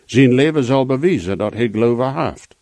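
usual speaking voice

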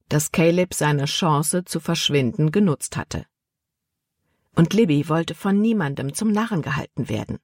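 usual speaking voice

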